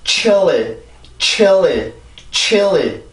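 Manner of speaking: chilly gliding as chi-eo-li; correct pronunciation demo. Shown as a demonstration. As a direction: say chilly in one flowing fall through a dark L. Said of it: "이렇게 해서 만들어지는 소리가". (chilly를 '치얼리'처럼 미끄러지듯 발음)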